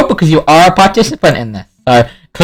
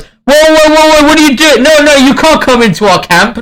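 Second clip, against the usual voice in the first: guard blocking the way; 9 semitones higher than usual. Acted. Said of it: "this guy here goes".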